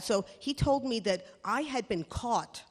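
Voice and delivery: ordinary talk, nothing marked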